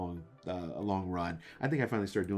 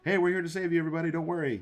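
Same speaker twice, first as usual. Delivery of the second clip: high, loud mock rescue call; mock heroic rescuer; 10 semitones higher, 6 dB louder, faster than usual. Imagined rescuers arriving for the group, mock heroic call. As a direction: call out like a hammed-up saviour.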